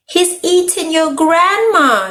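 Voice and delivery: high, drawn-out storybook yell; hammed-up high cry of alarm. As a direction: cry it out high, without full volume. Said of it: "yelled Rabbit".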